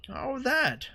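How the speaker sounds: put-on high, wavering old woman; old lady voice. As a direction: play her as a frail old lady. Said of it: "sound a little bit like an old lady George Bush".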